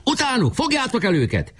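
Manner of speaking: high, urgent chase call; commander's order. A narrator's reading. Pásztor orders his redshirts after the runaways, high and urgent. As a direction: send your troops after them. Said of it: "Pásztor kiadta a jelszót".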